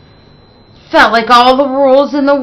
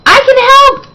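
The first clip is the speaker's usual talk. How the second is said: put-on much higher, louder eager child holler; 6 dB louder, faster than usual. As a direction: shout eagerly to be picked. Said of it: "Zoe hollered".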